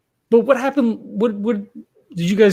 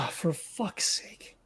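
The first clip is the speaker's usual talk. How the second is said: sighing whisper; whispered curse; 15 dB quieter, much slower than usual. Real, not acted. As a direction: breathe it out under your breath. Frustrated sigh at the confession.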